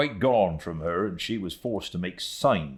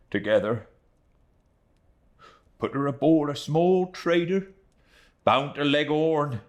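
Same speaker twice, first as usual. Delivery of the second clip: swelling emotion; 9 semitones higher, 3 dB louder than usual. A narrator's reading. Emotional retelling of kindness.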